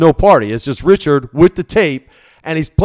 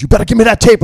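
high, louder mock threat; a retold tough-guy warning, pitched up and loud; 11 semitones higher, 8 dB louder, faster than usual. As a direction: bark the demand at him. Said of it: "My dad's".

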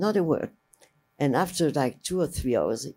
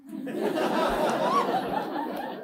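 real laugh. Real, not acted. Laughing at editor's "You are crazy.

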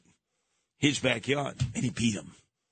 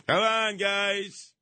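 insistent plea; 5 semitones higher, much slower than usual. Real, not acted. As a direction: push the plea harder. Repeats the plea more insistently.

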